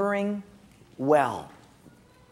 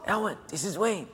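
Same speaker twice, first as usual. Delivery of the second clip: hushed, much softer phone whisper; 9 dB quieter than usual. Retold or imitated. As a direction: whisper it like you're hiding. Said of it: "what are you whispering for".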